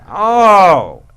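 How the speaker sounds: loud, brash salesman voice with laughs breaking in; Lee Iacocca. Retold or imitated. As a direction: boom it out like a car boss making a pitch. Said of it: "And he's Lee Iacocca".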